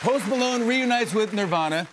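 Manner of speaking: high, loud, amazed repeat; excited announcing. Real, not acted. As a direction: proclaim it big, high and loud. Raving, repeats the surprise headline.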